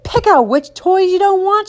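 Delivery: high-pitched bossy mom; mother laying down the law; ordering. A narrator's reading. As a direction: order the child about in a high voice. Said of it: "she ordered".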